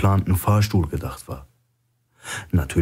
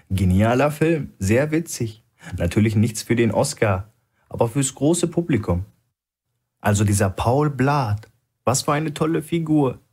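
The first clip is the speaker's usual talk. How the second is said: chatty tone; 5 semitones higher than usual. A narrator's reading. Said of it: "im Plauderton".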